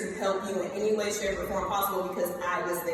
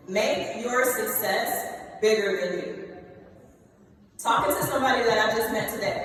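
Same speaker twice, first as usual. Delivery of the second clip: ordinary delivery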